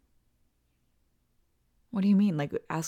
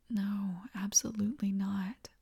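usual speaking voice

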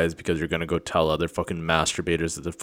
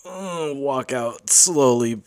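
slow mocking order; 7 semitones higher, much slower than usual. Ordering the accuser out.

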